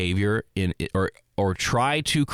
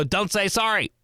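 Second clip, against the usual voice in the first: high-pitched, hammed-up telling-off; exaggerated mean scolding; 10 semitones higher, slower than usual. Shown as a demonstration. Turns round on an apologizing coworker, mock mean. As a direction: scold them far too harshly, mockingly. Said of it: "I'm being so mean to myself. I don't say it like that".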